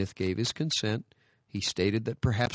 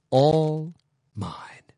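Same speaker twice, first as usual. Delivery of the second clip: soft whisper; 6 semitones higher, 6 dB louder than usual. Retold or imitated. Boy to his boat, whispered and tender. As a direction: breathe the last words to the boat. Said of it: "he muttered".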